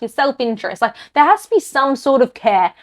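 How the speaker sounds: ordinary delivery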